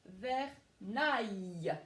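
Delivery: gouvernail dragged out slowly; teacher drawing out the word again; slow stretched word. Shown as a demonstration. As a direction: draw out gouvernail to the last sound. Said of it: "Il fallait mettre la croix dans la dernière".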